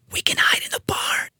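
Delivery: unvoiced, soft whisper; hushed, secretive suggestion. A narrator's reading. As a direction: whisper it so nobody hears. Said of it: "whispered Duffy".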